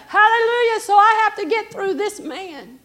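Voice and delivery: loud, high preacher's cry; impassioned preaching. Acted. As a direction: preach it at full force, stretching the key words. Preaches at full force.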